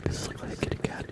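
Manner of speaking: soft unvoiced whisper close to the mic; hushed, breathy ASMR chatter. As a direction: whisper it all gently, ASMR style.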